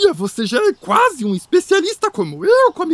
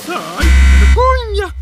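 hammed-up embarrassment; 7 dB louder, slower than usual. Grover acts out embarrassment.

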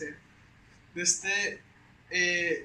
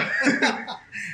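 loud laugh; 3 semitones higher, 8 dB louder than usual. Retold or imitated. Laughing at own yelling.

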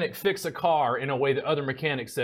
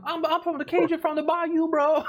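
high-pitched cartoon Cajun from the bayou; hammed-up Louisiana Cajun, pitched way up; mock Cajun accent. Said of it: "expect me to talk like that".

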